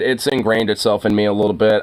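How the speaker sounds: ordinary talk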